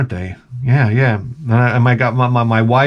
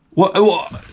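normal talking voice

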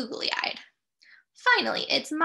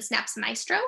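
plain reading voice

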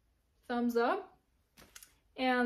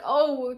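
imitated pained groan; 2 semitones higher, 8 dB louder than usual. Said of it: "they do this kind of".

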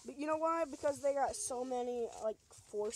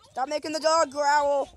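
much higher and louder scolding; loud exasperated telling-off; yelling at the dog; 5 semitones higher, 14 dB louder than usual. Real, not acted. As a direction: shout to stop the dog now. Scolding friend.

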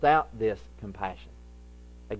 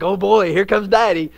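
mock excited kid; 7 semitones higher, 11 dB louder than usual.

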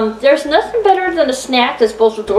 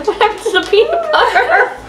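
laughing; 10 semitones higher than usual. Real, not acted. Laughing at the joke.

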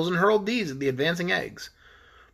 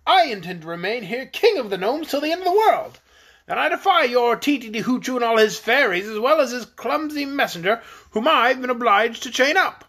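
raised, proud voice of a king defying the fairies; defiant gnome king; 7 semitones higher than usual. Acted. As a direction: throw down a challenge. King defies Tititi Hoochoo.

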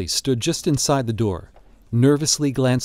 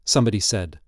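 ordinary delivery, nothing marked

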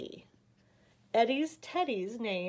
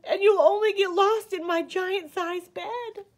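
high, louder, mock-tearful lament; sobbing bear; 8 semitones higher, 5 dB louder than usual. A narrator's reading. Bear laments his tiny teddy, sobbing. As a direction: keep up the acted crying, pitched high. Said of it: "could hear the voice bawl".

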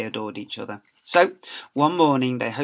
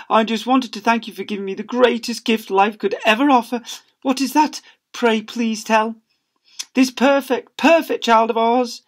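high, louder sugary voices; gushing parents; 9 semitones higher, 5 dB louder than usual. Acted. Husband and wife gushing over their child, high cooing. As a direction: gush lovingly and overdone.

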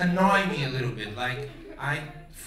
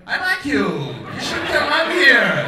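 loud, high call to the crowd; 7 semitones higher, 9 dB louder than usual. Real, not acted. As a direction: wave a fan up on stage. Calling to an audience member.

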